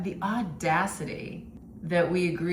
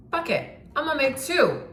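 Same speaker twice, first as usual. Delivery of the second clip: excited; 10 semitones higher, 4 dB louder than usual. Real, not acted. Sudden impulsive decision.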